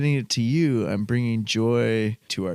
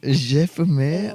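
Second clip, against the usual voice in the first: mock French "J'ai fumé", slow and higher; French accent; 4 semitones higher than usual. Husband echoing his wife's French line, slow mock French accent. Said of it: "in your best French accent".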